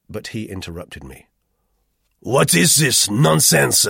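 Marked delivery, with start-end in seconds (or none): 2.2-3.8 put-on loud, high, slow accented demand; irritable. A narrator's reading. Roux interrupts the narrator, irritably. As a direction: snap it out loudly, high and irritated. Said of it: "he demanded irritably".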